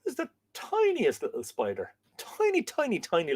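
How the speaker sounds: squeaky high tiny, tiny; high cooing voice. Real, not acted. Cooing over a tiny spider.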